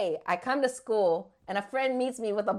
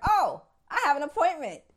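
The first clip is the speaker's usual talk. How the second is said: sudden remembering; 8 semitones higher than usual. Retold or imitated. Suddenly remembers lunch appointment.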